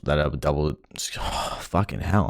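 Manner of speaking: usual speaking voice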